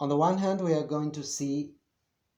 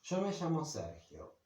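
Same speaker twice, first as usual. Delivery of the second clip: "Yo" raised in pitch, falling away to the name; contrastive emphasis; 5 semitones higher, 4 dB quieter than usual. Shown as a demonstration. Teacher shows the pronoun marking contrast, contrastive emphasis. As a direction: put the emphasis on "Yo". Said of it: "I'm putting the emphasis here".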